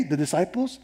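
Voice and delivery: ordinary talk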